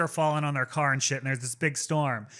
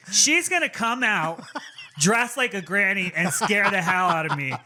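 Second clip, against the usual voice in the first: high, louder comic worry; mock dread; 5 semitones higher, 4 dB louder than usual. Retelling fear of a housemate as granny, comic alarm. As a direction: play up the fear, starting high.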